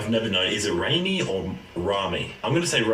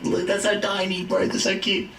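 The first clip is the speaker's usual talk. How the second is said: higher, gushing cute voice; cooing; 8 semitones higher than usual. Real, not acted. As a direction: gush over something tiny. Cooing over tiny shoes.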